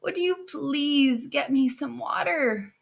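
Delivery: put-on high, slow pleading old woman; slow high thirsty old woman; old crone. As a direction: beg for water like a frail hag. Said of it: "and she hobbles over to her, and she goes".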